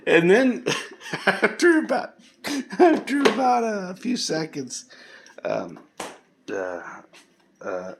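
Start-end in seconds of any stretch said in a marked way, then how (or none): 0.0-4.9 much higher and louder, words broken by laughter; laughing. Real, not acted. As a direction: laugh through it, barely getting the words out. Laughter breaking through narration.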